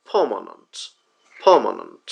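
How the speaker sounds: permanent as "PUH-muh-nuhnt", long first vowel; correct pronunciation. Shown as a demonstration. Teacher modelling permanent, long first vowel and weak endings. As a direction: stress permanent up front, weak schwas after. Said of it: "ভুল উচ্চারণ".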